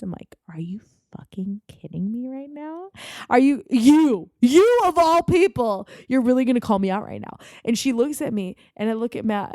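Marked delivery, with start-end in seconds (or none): 0.5-2.9 incredulous whispered threat; seething whisper. Acted. Whispering in disbelief at the drunk girl, seething. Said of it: "with the fucking devil eyes". 3.3-7.4 high, loud disbelief; indignant outburst. Acted. Confronting the drunk girl who called her out, loud indignation. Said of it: "with the fucking devil eyes".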